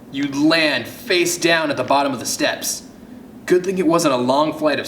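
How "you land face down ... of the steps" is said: ordinary delivery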